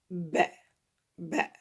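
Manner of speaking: "Ba" with both lips touching, then opening; lips-together sound. Shown as a demonstration. Teacher shows how the lips make it, careful demo. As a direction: close the lips and open them on "Ba". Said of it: "les deux lèvres, ça, ça se touche".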